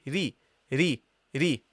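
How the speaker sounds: soft light "ri". Shown as a demonstration. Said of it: "pronounce it lightly".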